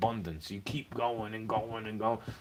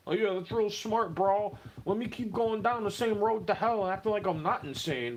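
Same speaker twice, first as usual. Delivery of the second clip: mocking quote; 7 semitones higher than usual. Mocking what others tell him.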